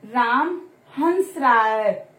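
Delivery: "हंस" said slowly, the n clearly sounded; slow teaching demo. Shown as a demonstration. Teacher demonstrating the nasal to children, slow and clear. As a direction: spell out "Ram हंस रहा है" slowly for pupils. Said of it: "अनुनासिक में क्या होता है".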